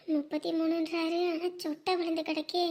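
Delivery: very soft thinking aloud; worried aside. Acted. Fretting privately that the bride may refuse him, hushed worried aside. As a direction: keep the doubts under your breath.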